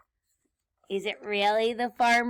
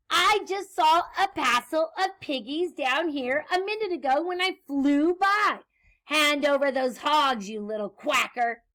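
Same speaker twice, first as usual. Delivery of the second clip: higher-pitched scolding mock screech; witch's screech; 4 semitones higher, 5 dB louder than usual. Witch orders duck to hand over hogs, mild screech. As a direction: scold in a raised, indignant voice. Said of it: "Grinch screeched".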